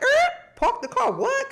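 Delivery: sudden high shocked squeak; mock-startled high yelp; shocked squeal. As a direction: jump way up in pitch, startled. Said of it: "they call me Mahalia".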